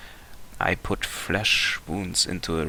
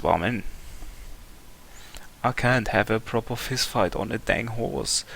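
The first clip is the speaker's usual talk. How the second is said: ordinary talk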